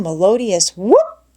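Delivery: ordinary delivery, nothing marked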